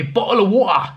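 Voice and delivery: "bottle of water" with glottal stops for the T's; dropped-T British slang. Retold or imitated. Foreign seller trying to impress an English tourist, dropped-T British slang. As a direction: say "bottle of water" London-style, T's swallowed. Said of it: "someone is not English tells you".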